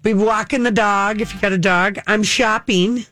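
usual speaking voice

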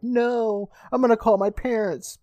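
high-pitched mock protest; protesting kid. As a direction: imitate a kid objecting in a panic. Retold protest of trapped kid.

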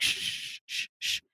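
long shush, then short ones; mock shushes cutting someone off; imitated shushing. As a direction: hush the driver insistently. Imitates caller shushing the driver.

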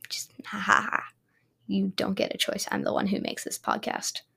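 soft unvoiced whisper. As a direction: whisper it like a secret threat. Whispered evil laugh.

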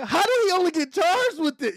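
high, loud disbelief; baffled judge. Retold or imitated. Judge questioning the light charges, high and baffled. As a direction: pitch it up like she can't believe it. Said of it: "she was like".

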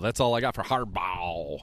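usual speaking voice

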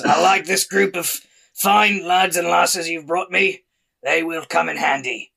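high gravelly foreman voice; gravelly half-orc. Acted. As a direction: growl hearty approval to the caravan leader. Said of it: "sometimes the gravelly voice takes a lot".

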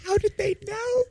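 high, soft, eerie little voice; creepy child voice. Acted. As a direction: sound like a spooky child.

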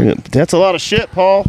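high sing-song jeer; high teasing voice. Acted. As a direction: tease him in a high voice. Teasing Paul about his tank.

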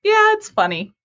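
very high-pitched polite humoring; imitating unamused client. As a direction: humor someone's joke in a high little voice.

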